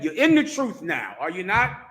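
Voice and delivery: usual speaking voice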